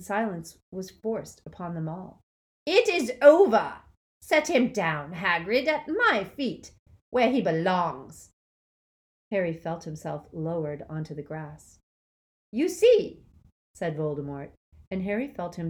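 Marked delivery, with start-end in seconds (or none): 2.7-8.3 loud, high, gloating dark lord; Voldemort triumphant. A narrator's reading. Dark lord gloating to the crowd, triumphant shout. Voldemort proclaims Harry's death. 12.5-13.2 loud, high, gloating dark lord; Voldemort triumphant. A narrator's reading. Dark lord gloating to the crowd, triumphant shout.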